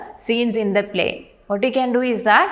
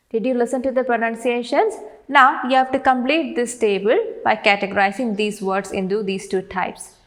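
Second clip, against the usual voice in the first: ordinary talk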